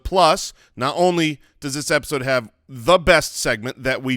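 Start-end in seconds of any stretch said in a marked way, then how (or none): none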